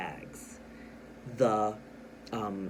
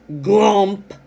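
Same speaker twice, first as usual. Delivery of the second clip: GLUMP shouted high and loud; stressed exclamation; 7 semitones higher, 14 dB louder than usual. Shown as a demonstration. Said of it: "I stressed this word glump".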